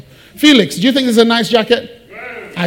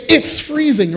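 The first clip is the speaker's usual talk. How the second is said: It's freezing said much higher, with a stressed its and a falling end; exaggerated complaint; 8 semitones higher, slower than usual. Shown as a demonstration. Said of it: "I say".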